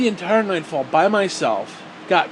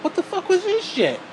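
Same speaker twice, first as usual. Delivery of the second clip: baffled outburst; 13 semitones higher than usual. Retold or imitated. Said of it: "and you're like".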